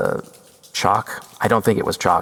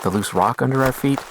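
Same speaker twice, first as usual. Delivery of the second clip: ordinary talk, nothing marked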